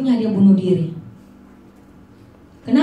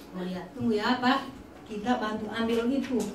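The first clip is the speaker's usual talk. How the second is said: soft, sugary call hiding anger; sweet gentle call; 2 semitones lower, 15 dB quieter than usual. Retold or imitated. Hostess softly calling Maria while secretly annoyed. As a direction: sound gentle though you're fuming. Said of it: "panggil dengan nada, apa namanya, lembut".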